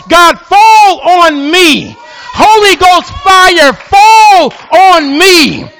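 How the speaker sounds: very slow, high shouted plea; crying out to God. Pretend. Preacher voicing the people's cry, slow high shout. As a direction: stretch each word out, pleading. Cries out the prayer.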